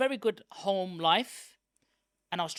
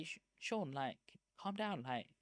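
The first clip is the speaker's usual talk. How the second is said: calming; 7 dB quieter than usual. Retold or imitated. Friends calming him down.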